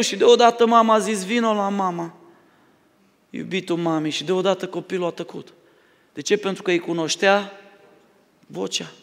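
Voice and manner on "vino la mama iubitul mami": soft, hushed motherly coaxing; a mother soothing her newborn, softly and tenderly. Retold or imitated. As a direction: coo gently to a crying baby. Mother calls her crying baby.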